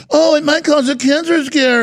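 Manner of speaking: high, sing-song mock of the experts. Mocking the experts' early warning, in a high put-on voice. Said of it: "they're like".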